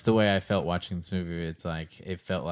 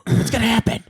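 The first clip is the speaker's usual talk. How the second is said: panicky; 10 dB louder than usual. Retold or imitated. Acting anxious moviegoer.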